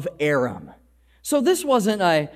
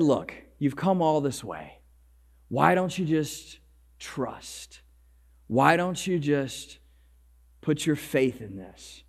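coaxing plea; 4 semitones lower, 7 dB quieter than usual. Retold or imitated. Servants gently persuading Naaman.

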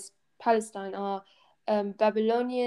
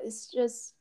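usual speaking voice

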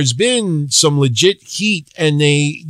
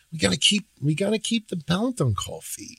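much softer, "gotta" stressed, repeated hesitantly; hushed, reluctant; 11 dB quieter than usual. Shown as a demonstration. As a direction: mutter it as if forced. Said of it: "it's like".

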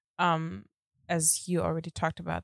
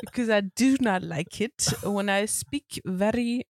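hammed-up German accent, pitched up; 4 semitones higher than usual. Shown as a demonstration. Wife trying the German one at husband's prompting, German accent. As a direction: attempt a German accent, higher and deliberate. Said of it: "You should try the German one".

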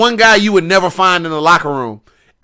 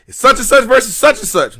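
higher-pitched mock match announcer; lightly put-on voice rattling off matchups; 6 semitones higher than usual. Guy on the mic announcing the matches, mock announcer.

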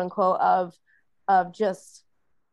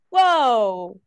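loud, drawn-out whoa; amazed realisation; 3 semitones higher, 7 dB louder than usual. Retold or imitated. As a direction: act out a sudden revelation, big and loud. Retells her sudden realisation.

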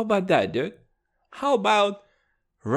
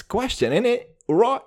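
high, hammed-up British accent; 6 semitones higher, 4 dB louder than usual. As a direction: lay on the mock British thick. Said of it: "Dude, I'm so British".

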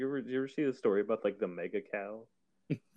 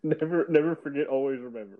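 laughing slogan; 6 semitones higher, 6 dB louder than usual. Pretend. Jokey misquote of a slogan.

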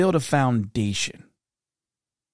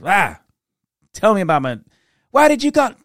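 louder, rising to a high angry yell; aunt yelling; 9 semitones higher, 6 dB louder than usual. Retold or imitated. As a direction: tell off the kid, building up. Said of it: "she'd flash on me".

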